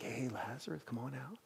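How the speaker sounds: soft offhand call, barely voiced; casual quiet call. Shown as a demonstration. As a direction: toss it off quietly, not a summons. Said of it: "It wasn't like".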